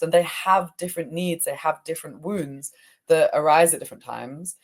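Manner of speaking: usual speaking voice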